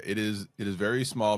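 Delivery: ordinary delivery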